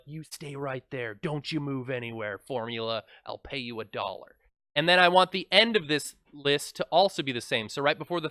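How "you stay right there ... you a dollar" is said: soft, low coaxing to the formula; bribing voice. Acted. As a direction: sweet-talk it like a bribe. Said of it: "It's like I'm bribing this formula".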